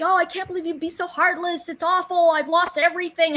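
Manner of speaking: high, loud mock wail of an upset client. Imitating a client who felt she had lost everything, high and loud. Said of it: "And she was like".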